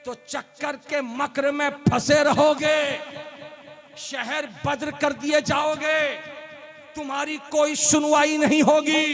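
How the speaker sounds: rising fiery sermon voice; preaching. Acted. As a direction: build from firm to loud preaching. Sermon builds to a shout.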